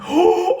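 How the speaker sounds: sharp high gasp of disbelief; shocked gasp. Real, not acted. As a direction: gasp as the card is revealed. Pulls rare card, shocked.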